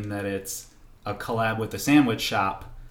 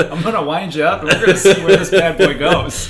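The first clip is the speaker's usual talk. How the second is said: laughing; 7 semitones higher, 9 dB louder than usual. Real, not acted. Laughing, teasing the co-host.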